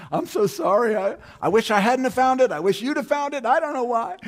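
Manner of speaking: high, loud, pleading apology; frantic apologizing. Retold or imitated. Husband apologizing to crying wife, high frantic pleading. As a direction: grovel in a hammed-up high voice. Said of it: "I just started apologizing".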